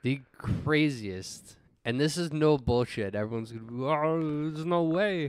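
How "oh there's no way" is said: put-on voice of a doubter; mock disbelief. Everyone reacting to his story, mock disbelief. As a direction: imitate everyone scoffing at the tale.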